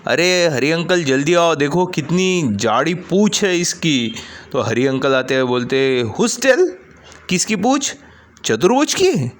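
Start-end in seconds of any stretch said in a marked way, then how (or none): 0.1-4.2 put-on little girl shouts to Uncle Hari; little girl calling. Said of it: "वो आवाज़ देती है". 6.0-9.3 very high teasing squeak; Uncle Hari, squeaky high. Acted. Uncle Hari's teasing question.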